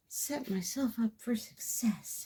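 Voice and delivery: high, slow, whispery echo; whispery self-reminder. Real, not acted. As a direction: repeat it softly to yourself, drawn out. Echoes own phrase to herself.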